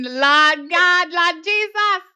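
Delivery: high, loud Jamaican exclamation; Jamaican accent. Pretend. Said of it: "here comes your Jamaican accent".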